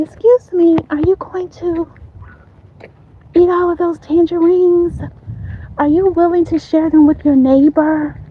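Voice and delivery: high-pitched, pretend neighborly ask; rehearsed polite request.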